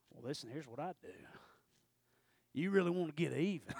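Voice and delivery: put-on low, soft, scheming old man whisper-ish voice; sly old man. The old man inside offering advice, low and sly. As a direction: lean in and tempt softly.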